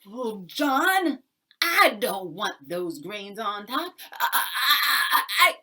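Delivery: high, loud laugh-cry of frustration; tricked landlord sputtering, high and loud; sputtering dismay. Acted. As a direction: stammer in helpless outrage.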